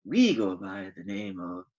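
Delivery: growled. Acted. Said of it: "growled Don Diego".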